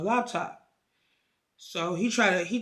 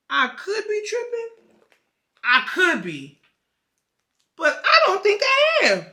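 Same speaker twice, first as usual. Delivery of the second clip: high-pitched disbelief; 13 semitones higher, 7 dB louder than usual. Retold or imitated. Acts out man doubting, then sure.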